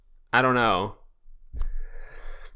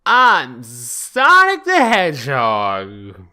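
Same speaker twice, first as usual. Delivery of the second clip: slow, louder proud boast; proud Sonic; 5 dB louder, slower than usual. Acted. Sonic introducing himself to the receptionist, proud. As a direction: announce yourself grandly.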